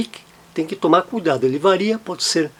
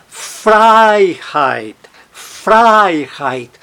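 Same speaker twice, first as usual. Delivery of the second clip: "Freiheit" with a long, strong FREI; stress on first syllable; 3 semitones higher, much slower than usual. Shown as a demonstration. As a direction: lean on Frei in "Freiheit", leaving heit weak. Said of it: "A sílaba tônica não está no reit".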